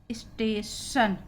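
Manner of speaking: "स्टेशन" slowly, ending in shan; drawn-out teacher's "स्टेशन", sh before the end. Shown as a demonstration. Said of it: "शलगम वाले सा के रूप में".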